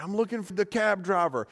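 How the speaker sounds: high, loud call across a crowd; yelling over a crowd of cabbies. Retold or imitated. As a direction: shout it out to find the driver. Calling out among crowding cabbies.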